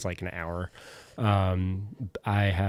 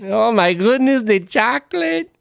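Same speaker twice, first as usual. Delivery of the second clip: high, loud put-on voice; Willy Wonka voice; 14 semitones higher, 8 dB louder than usual. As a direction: gush like a wacky candy maker.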